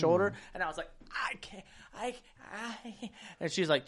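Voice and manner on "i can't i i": breathless whisper; strained whisper. Retold or imitated. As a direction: squeeze out a whisper. Retelling strained moment.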